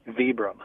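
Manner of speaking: "Vibram" said VEE-brum; "Vibram" starting like "vee"; long-E Vibram. Shown as a demonstration. Guest a recent convert to family usage, "Vibram" as VEE-brum. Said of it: "learning the way that the family name".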